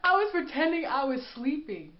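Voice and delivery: loud high burst of laughter into the words; cracking up. Real, not acted. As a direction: laugh hard then push the line out. Cracking up at own story.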